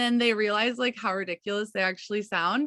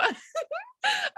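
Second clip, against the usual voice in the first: real high, squeaky burst of laughter; laughing burst. Real, not acted. Caught laughing at her own bad example, high squeaky giggle.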